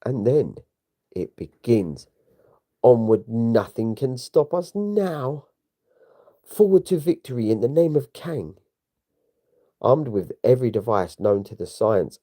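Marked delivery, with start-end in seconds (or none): 2.8-8.6 rallying battle cry. Acted. Invaders rallying the charge.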